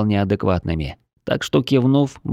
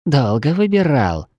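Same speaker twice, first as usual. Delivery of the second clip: snide; 4 semitones higher than usual. Acted. Lizard girl keeps mocking.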